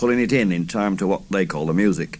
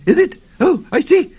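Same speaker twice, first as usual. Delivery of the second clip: put-on high, loud, trembling old voice; shaky old man; 6 dB louder than usual. A narrator's reading. Old man answering the smiling gang, shaky and high. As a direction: quaver like a frightened old man. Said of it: "he said, all shaky".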